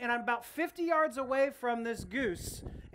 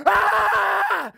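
loud, high shout of rage; battle yell; 10 semitones higher, 15 dB louder than usual. Retold or imitated. Pastor retells yelling at the charging goose, loud high battle cry. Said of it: "And I just yelled".

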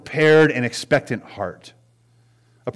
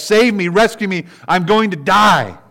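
high, loud desperate plea for rescue; crying out in distress; 8 semitones higher, 8 dB louder than usual. Acted. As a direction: cry out as if begging to be saved. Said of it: "crying out loud in distress".

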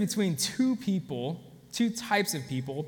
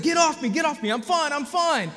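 high, louder, agitated voice of a confused injured man; delirious patient; 9 semitones higher, 5 dB louder than usual. Retold or imitated. High and agitated, a dazed patient shouting at the medics. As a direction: push the medics away, frantic and high. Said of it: "He's like".

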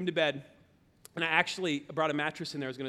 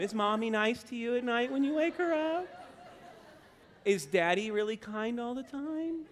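put-on higher, sweet questioning of little kids; mock interviewer; 5 semitones higher than usual. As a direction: lightly act out quizzing children, pitch climbing at the end.

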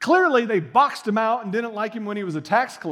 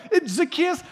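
ordinary talk, nothing marked

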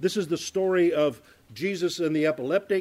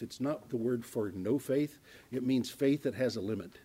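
normal talking voice

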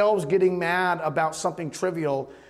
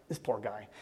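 soft, low, pitying aside; pitying murmur; 10 semitones lower, 12 dB quieter than usual. Retold or imitated. To himself about the poor customer, soft pity. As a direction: mutter it quietly in sympathy.

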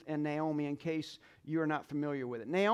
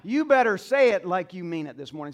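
rousing call to respond; 3 semitones higher, 6 dB louder than usual. Acted. Pastor calling congregation to respond.

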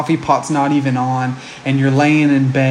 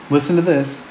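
ordinary talk, nothing marked

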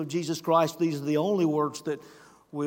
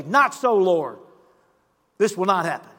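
loud protest, pitch jumping up; Peter protesting; 7 dB louder than usual. Acted. Peter refusing Jesus, loud protesting voice.

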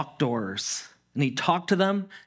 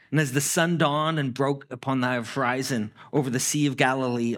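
ordinary talk

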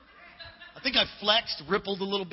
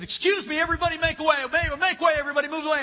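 loud, higher call to clear the crowd; 7 semitones higher, 4 dB louder, faster than usual. Retold or imitated. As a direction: make way, raised voice over the scene. Calling crowd away at crash.

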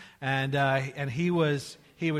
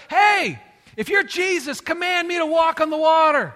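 loud, high shout across the water; big, high-pitched, retold call; 10 semitones higher, 10 dB louder than usual. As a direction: holler it out as Peter's bold challenge. Peter calls to Jesus on water.

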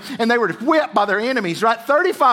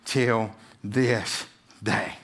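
very slow, low and softer to a close; solemn slow fade; 11 semitones lower, 5 dB quieter, much slower than usual. Real, not acted. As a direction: let the story sink in gravely.

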